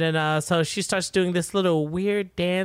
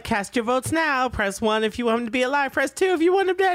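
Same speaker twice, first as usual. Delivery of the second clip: mock game-show host; 7 semitones higher than usual. Villanelle polling the crowd.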